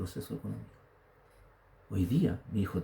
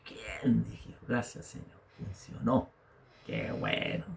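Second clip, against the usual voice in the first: retold hushed thanks; 7 dB quieter, slower than usual. Preacher recalls thanking the Lord for his raise, hushed. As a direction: quietly thank God under your breath.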